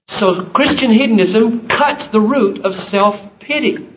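high, loud, slow preaching; full-force preaching. Acted. Pastor preaches passionately to the congregation, high and loud. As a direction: preach it out with fervour.

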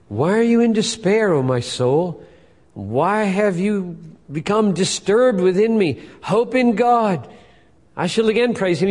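high, loud pleading with his own soul; psalmist crying out. Acted. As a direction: call out to your soul, slowing on the plea. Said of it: "Here's the psalmist doing it".

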